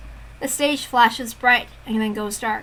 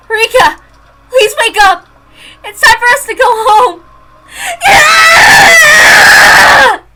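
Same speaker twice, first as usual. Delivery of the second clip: high loud wailing pleas; sobbing pleas; 12 semitones higher, 13 dB louder, much slower than usual. Acted. Girl over her friend's body, wailing pleas. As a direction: beg her dead friend to wake up.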